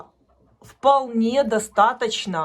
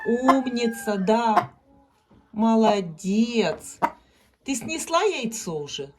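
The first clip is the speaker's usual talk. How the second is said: talking to a hen; 3 semitones lower than usual. Real, not acted. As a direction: coo gently to calm the hen. Coaxing a hen off the nest.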